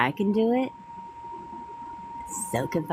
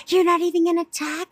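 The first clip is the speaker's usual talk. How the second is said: higher, shrill-pitched mock indignation; 12 semitones higher, 3 dB louder than usual. Mimicking the boyfriend's ex, mock indignant and high. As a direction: squeak it out like an offended ex. Imitating the ex-girlfriend's outburst.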